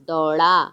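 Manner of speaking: flick the tongue in the middle of "दौड़ा"; flapped ड़. Shown as a demonstration. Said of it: "तो हम इसको बोलेंगे".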